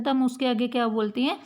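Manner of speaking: normal talking voice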